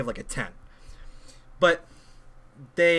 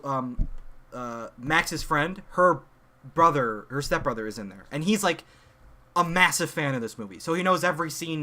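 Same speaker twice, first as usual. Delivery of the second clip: normal talking voice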